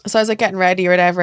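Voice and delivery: ordinary talk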